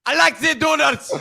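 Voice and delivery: put-on high, loud German accent; exaggerated German accent, loud; movie villain German accent. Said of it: "can you say doodles with a German accent".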